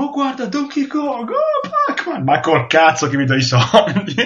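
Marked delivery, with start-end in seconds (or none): 0.0-2.2 much higher, gushing fanboy voice; mocking excited fan. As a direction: parody the starstruck gamer, squealing. Mocking excited fans in trailer.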